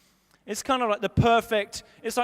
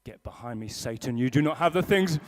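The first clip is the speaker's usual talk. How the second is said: mock rebuke; 12 semitones lower than usual. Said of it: "So I turn to her".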